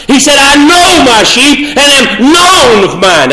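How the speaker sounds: high, sustained pulpit delivery; sermon climax, pitched well up; impassioned preaching. Acted. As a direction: preach it with full conviction, leaning on key words. Sermon reaches its peak.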